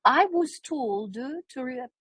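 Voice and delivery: very slow, drawn out; slow dialogue read. Shown as a demonstration. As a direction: lay it out word by word. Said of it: "Dialect number two".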